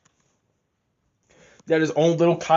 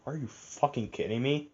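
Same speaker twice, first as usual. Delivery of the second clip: under my breath; 11 dB quieter than usual. Retold or imitated. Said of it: "I said that under my breath".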